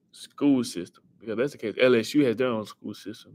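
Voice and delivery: ordinary talk, nothing marked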